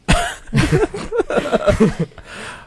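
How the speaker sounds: long, high-pitched giggling laugh; laughter. Real, not acted. Storyteller after saying dad went to jail, real laughter. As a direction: crack up after your own punchline.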